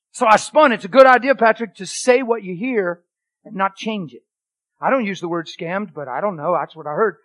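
loud, high, driving preacher's voice; full-force preaching. Acted. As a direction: preach it out to the room.

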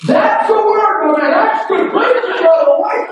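hammed-up amen from the pew; mock elder praise. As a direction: call out praise like an excited old deacon. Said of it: "he'll go sit in his chair and say".

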